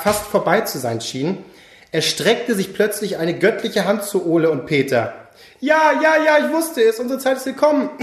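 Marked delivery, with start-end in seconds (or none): 5.6-7.9 high, elated cry; excited. Acted. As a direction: burst out in hopeful joy. Ole thinks he is chosen.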